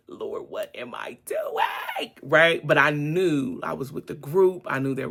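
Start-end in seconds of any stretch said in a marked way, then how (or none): 0.1-2.1 high-pitched retold wail to God; mock panic. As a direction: stretch it out, very high, in comic dread.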